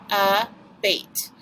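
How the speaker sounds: ABATE in two separate beats, "a", then "bait"; split into syllables. Shown as a demonstration. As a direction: break abate after the a. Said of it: "We do the syllables".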